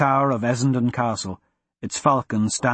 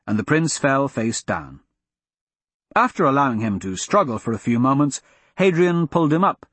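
ordinary delivery

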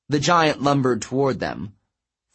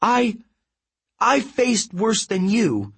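high, faltering defiance; crying out; 11 semitones higher, slower than usual. A narrator's reading. Girl answering the giant's taunts, crying out. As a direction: cry out defiantly, stumbling at first. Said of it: "Annabeth cried".

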